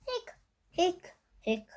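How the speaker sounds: high little hiccups; hiccup sounds. Acted. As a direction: hic out each one like a hiccup. Skeleton has the hiccups.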